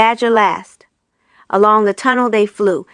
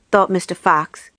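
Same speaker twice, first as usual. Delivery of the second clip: ordinary delivery, nothing marked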